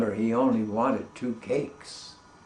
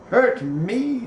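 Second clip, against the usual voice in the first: mocking disbelief, much higher and louder; scoffing; 9 semitones higher, 6 dB louder than usual. The cat scoffs at the king's warning, high loud mock surprise. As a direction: sneer it back in disbelief.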